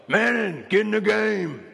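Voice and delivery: high, hollered order from the bench; drawn-out sideline holler; coach's yell. Retold or imitated. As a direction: imitate a coach yelling a player in. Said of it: "I hear Coach yell".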